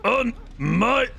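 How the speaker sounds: slow, high mock hero line; heroic vow. Repeats heroic vow, drawn out.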